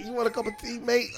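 a light imitation of an English accent. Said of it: "English like that, like".

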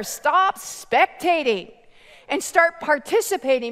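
urgent pulpit charge; rousing preaching. Real, not acted. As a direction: drive each command home, stretching the last ones slow. Preacher urges the congregation on.

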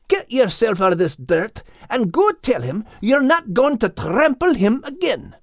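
high voice with a Scottish lilt; Scottish terrier voice. A narrator's reading. Max lecturing the crying bull, high Scottish voice. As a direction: play the bold Scottish dog, high and firm.

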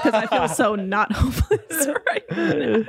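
laughing confession. Real, not acted. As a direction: confess it through helpless laughter. Confesses sheepishly, cracking up with friends.

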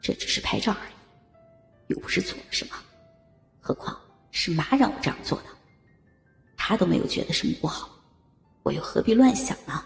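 low breathy inner voice; whispered thoughts. Acted. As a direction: murmur it to yourself, hushed.

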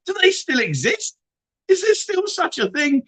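squeaky high mock astonishment; high-pitched incredulous squeak; mock disbelief. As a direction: act amazed that they still exist. Mocking disbelief at travel agents.

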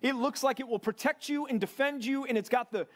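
fervent preacher rising up over the congregation; full-force preaching. Real, not acted. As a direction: preach it out to the men, building conviction.

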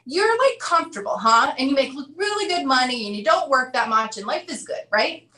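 high, teasing "So you're, like, comfortable, huh?" with a taunt; mocking challenge. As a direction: needle a smug crowd member. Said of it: "He said".

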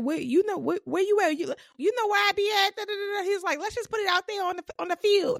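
high, fast mock challenge as Saucy; imitating Saucy. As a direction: pitch it up and taunt like Saucy. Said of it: "And he's like".

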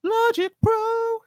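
Logic Pro sung very high and slow; mock fanfare. Shown as a demonstration. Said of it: "I use".

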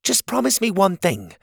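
a hint of a bright young helper; Cogleen's voice.

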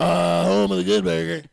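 loud, raspy fast-food cashier impression; too raspy. Shown as a demonstration. Comic trying a burger cashier impression, too loud and raspy. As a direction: overdo the rasp, nice and loud. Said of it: "That's too raspy".

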